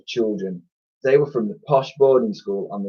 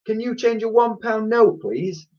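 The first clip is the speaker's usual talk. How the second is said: put-on high, polite child's voice; high-pitched boyish request; boy asking; 12 semitones higher than usual. A narrator's reading. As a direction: ask timidly like a small customer. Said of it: "asked the boy".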